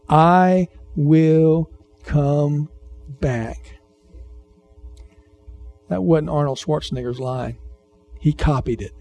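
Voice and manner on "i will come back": very slow, each word held; drawn-out promise. Acted. Preacher quoting Jesus's promise to return, drawn out and deliberate.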